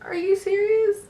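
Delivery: very high-pitched disbelieving question; incredulous. Retold or imitated. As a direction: act out can't-believe-you disbelief. Daughter incredulous at mom's worry.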